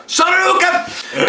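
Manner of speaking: very high, slow, drawn-out battle cry; fighting game yell. Retold or imitated. Host comparing Freddy's uppercut to a game, with a very high drawn-out battle cry. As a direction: scream the special move like Street Fighter. Video game special move callout.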